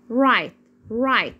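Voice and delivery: "رايت" with no g sound at all; teacher's model "رايت", gh silent. Shown as a demonstration. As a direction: pronounce "رايت" like "rite". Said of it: "صامتة ما بنطقهاش".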